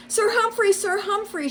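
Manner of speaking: very high, loud calls; shouting. A narrator's reading. Rabbit shouting for Sir Humphrey, very high and loud. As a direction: call his name frantically, hopping. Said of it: "she shouted".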